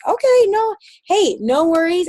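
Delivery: high, light no worries; breezy reassurance. Retold or imitated. Telling a teammate who declined, in breezy reassurance. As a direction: let them off the hook kindly. Accepting a no gracefully.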